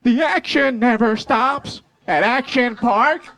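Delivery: slow, hammed-up ad announcer; TV commercial voice. Mimicking the water park TV commercial, hammed-up announcer. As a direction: sell it like a cheesy summer ad. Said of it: "the commercial would come on".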